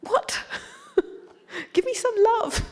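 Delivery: put-on very high, squeaky child's demand breaking into laughter; indignant child. Speaker mocking the child in that moment, indignant and laughing. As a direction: play a put-out kid, laughing at yourself. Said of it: "indignant child, going".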